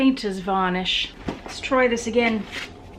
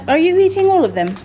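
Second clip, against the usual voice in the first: higher, louder, fast alarm; real surprised scolding of dog; 7 semitones higher, 7 dB louder, faster than usual. Real, not acted. Dog gobbling treats.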